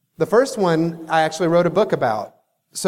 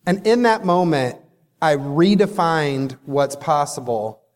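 ordinary delivery

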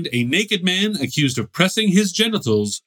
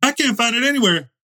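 high mock voice; 9 semitones higher than usual. Acting out the orgy scene.

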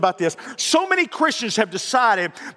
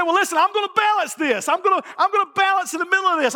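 high-pitched imitation of an excuse-maker; mock protest in a raised pitch; insistent self-justifying; 10 semitones higher than usual. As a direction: act out someone insisting, pitched well up. Voicing a self-justifying churchgoer's excuse.

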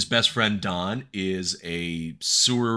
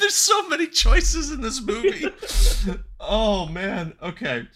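high, squeezed laughing voice; helpless laughter; 10 semitones higher than usual. Real, not acted. Podcaster marvels at a bad movie's choices, cracking up. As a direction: barely get it out for laughing.

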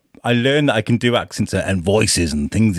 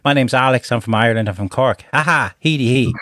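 Irish accent. Shown as a demonstration. Practising Irish accents around the house, cheery Irish accent. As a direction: introduce yourself as a cheery lad from Cork in an Irish accent. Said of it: "can you do an Irish accent".

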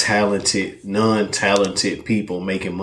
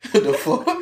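high laughing disbelief; faster than usual. Real, not acted. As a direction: laugh it out, pitched way up. Laughing at the icebreaker question.

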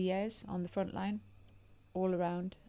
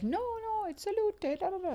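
much higher, fussy posh Italian voice; posh Italian date; 13 semitones higher than usual. Retold or imitated. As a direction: mimic the snobby Italian correcting her. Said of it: "'cause he was very posh".

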